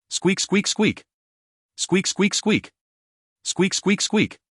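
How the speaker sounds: high-pitched squeaks in quick threes; mouse squeaks. A narrator's reading. Frenzied little mouse taken from the liquid, high squeaking.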